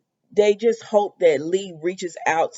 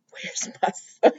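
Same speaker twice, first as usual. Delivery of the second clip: slow, whispered "Where's my son?"; creepy whisper; slower than usual. Retold or imitated. Sheila at Lee's door, a slow spooky whisper. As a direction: breathe it out slow like a lurking villain. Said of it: "and Sheila said".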